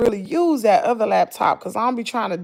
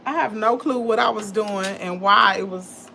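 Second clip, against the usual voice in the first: usual speaking voice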